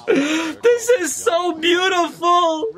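high, slow, wailing joy; real fan overwhelmed with delight; overjoyed gushing. Real, not acted. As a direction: stretch it out as if near happy tears. Overjoyed fan watching his favorite.

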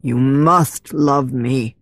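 slow, low command with weight on must; a commanding unseen man, drawn out; man's voice behind a door. A narrator's reading. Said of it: "that man's voice behind the door".

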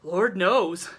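loud, blunt country shrug; candid. A narrator's reading. Old woman answers about the baby's future, candid. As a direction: toss it off frankly. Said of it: "said Missus Conover candidly".

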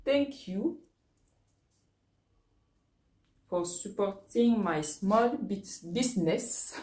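halting English read with a strong French accent. Real, not acted. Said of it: "mon accent est très ... mon anglais est très mauvais". (anglais hésitant avec accent français)

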